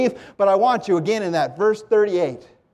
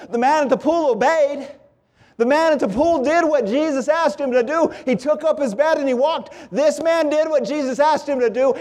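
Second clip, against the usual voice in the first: higher-pitched, driving sermon cadence; impassioned preaching; 7 semitones higher than usual. Real, not acted. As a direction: build each repeated phrase like a preacher. Preacher contrasts the two men.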